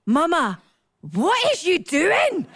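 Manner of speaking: put-on high, slow little-child voice; toddler voice. Toddler son asking his mum onstage, high slow child voice. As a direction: squeak it out like a baffled toddler.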